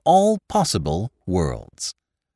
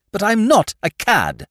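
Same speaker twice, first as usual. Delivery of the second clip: indignant; 11 semitones higher, 3 dB louder than usual. Acted. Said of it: "Wrath is good for the complexion".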